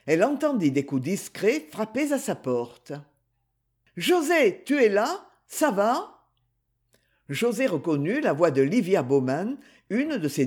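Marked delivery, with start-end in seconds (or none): 4.0-6.1 higher-pitched voice of a friend checking in; higher, questioning visitor's voice; concerned friend calling. A narrator's reading. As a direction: call gently through a door, pitched up. Friend calls through the door.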